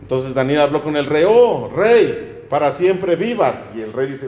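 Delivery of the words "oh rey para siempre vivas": calling out. Acted. Daniel calls from the den.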